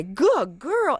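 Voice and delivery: high-pitched, bright dog praise; praising a dog. Acted. Trainer rewarding dog for turning back, high praise.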